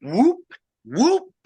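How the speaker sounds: two high rising whoops; imitated whoops. Retelling the forest answering the whoop, high whoops. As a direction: fire off quick calls like creatures answering. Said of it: "the forest came undone".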